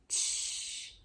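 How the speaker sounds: one soft tch; voiceless tch. Shown as a demonstration. Teacher repeating the sound. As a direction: release tch softly. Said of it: "Ele é só aqui".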